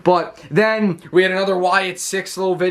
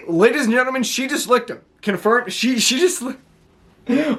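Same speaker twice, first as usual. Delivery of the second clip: imitating Michael Cole; 6 semitones higher than usual. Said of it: "Michael Cole goes".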